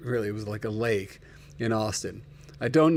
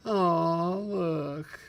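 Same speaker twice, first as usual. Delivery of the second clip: usual speaking voice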